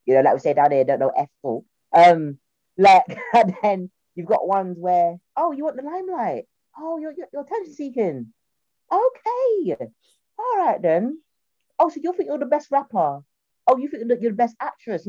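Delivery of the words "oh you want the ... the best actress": high, softened snide put-down; exaggerated "oh, really?" tone; mock sarcasm. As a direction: taunt a show-off in a raised mocking voice. Voices snide remarks to attention seekers.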